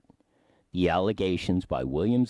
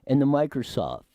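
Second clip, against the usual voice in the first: usual speaking voice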